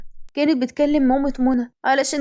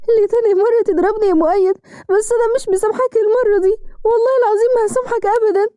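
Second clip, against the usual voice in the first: higher, sobbing and heartbroken; crying bitterly; 7 semitones higher than usual. A narrator's reading. Wife slapped by husband, crying bitterly through tears. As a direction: cry out the hurt through tears. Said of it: "فضلت تعيط بقهر".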